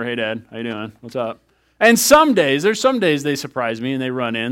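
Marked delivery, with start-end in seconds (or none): 0.0-1.4 offhand hello. Retold or imitated. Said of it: "So it's like, whatever".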